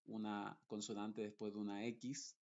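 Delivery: ordinary delivery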